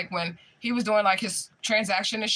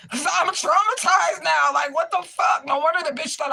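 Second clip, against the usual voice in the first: traumatized outburst; 8 semitones higher, 4 dB louder than usual. Real, not acted. Venting about being traumatized at work.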